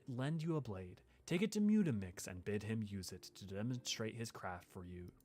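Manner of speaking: soft, lightly voiced noble lady; a gentle, measured quest-giver; Minfilia voice. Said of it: "Love your Minfilia voice".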